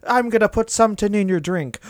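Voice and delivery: high, laughing Irish voice; leprechaun. Retold or imitated. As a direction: cackle it like a leprechaun. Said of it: "Mystic Mac foretells".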